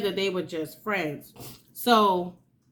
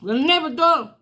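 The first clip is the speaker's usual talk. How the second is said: ordinary talk, nothing marked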